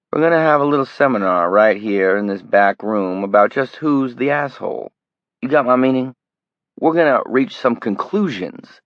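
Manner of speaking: sneering threat. Acted. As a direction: taunt the college boy. Greg taunting and threatening the kid.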